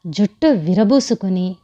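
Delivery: usual speaking voice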